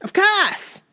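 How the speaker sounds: high Boston accent imitation. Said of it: "How did he say it, do you think?".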